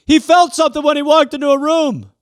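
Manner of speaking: awed believers. Retold or imitated. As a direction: play an impressed TV fan. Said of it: "the people are like".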